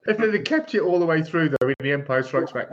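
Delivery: ordinary delivery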